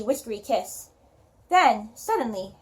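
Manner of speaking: ordinary delivery, nothing marked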